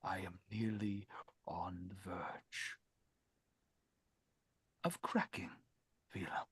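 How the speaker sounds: breathy near-whisper, barely holding together; strained whisper. Acted. Lord admits to the artisan he is cracking, whispered. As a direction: confess it under your breath.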